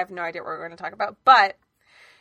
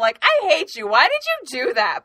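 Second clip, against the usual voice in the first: loud, raised-pitch mock outrage; mock family quarrel; 4 semitones higher, 8 dB louder than usual. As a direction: fling playful insults at full volume. Acts out family's harmless insults.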